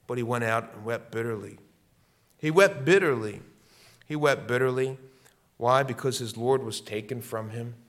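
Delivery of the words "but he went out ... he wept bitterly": usual speaking voice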